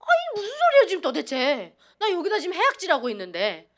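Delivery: loud, high, incredulous retort; indignant disbelief. Retold or imitated. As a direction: blurt it out as if the doctor is talking nonsense. Retorting to doctor inside her head.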